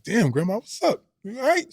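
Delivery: high-pitched startled question; taken aback, rising at the end; alarmed concern. Retold or imitated. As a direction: ask it in worried disbelief, voice shooting up. Startled grandson asks grandma about pills.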